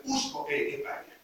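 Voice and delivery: hushed, breathy whisper of conviction; quiet whispered emphasis. Real, not acted. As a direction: whisper it like a secret truth. Repeats key phrase, then plainly.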